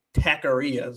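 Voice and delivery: "Taqueria" with an English "tack" and an American r; anglicized "Taqueria"; gringo pronunciation. Shown as a demonstration. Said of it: "the gringo way of saying it".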